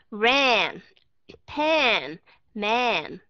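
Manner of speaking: "Ran, pan, man" with the mouth wide open; teacher modelling short A words. Shown as a demonstration. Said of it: "các bạn cứ mở miệng lớn ra".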